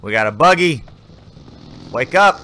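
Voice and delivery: re-enacted yell at a buddy; retold yelling. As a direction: rouse a dozing teammate, rising at the end. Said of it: "I started yelling at him".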